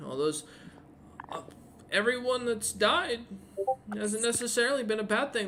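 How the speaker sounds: put-on high, eager boy's voice; a kid pleading his case to the hero, in a high earnest voice; earnest young kid.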